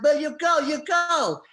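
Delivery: loud, high imitation of an angry cleaner; Mexican woman protesting. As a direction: mimic her yelling through the door. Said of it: "we've got the woman going".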